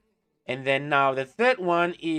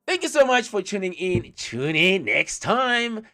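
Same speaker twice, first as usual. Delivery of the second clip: loud, high-pitched thanks to the audience; big show-host farewell, stretching the last words; upbeat sign-off; 8 semitones higher, 7 dB louder than usual. Real, not acted. Host's upbeat sign-off to viewers.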